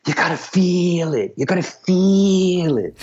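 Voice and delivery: high, insistent quote with a long "feel". Retold or imitated. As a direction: imitate his high voice, stretch "feel" longer the second time. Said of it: "what he always used to say was".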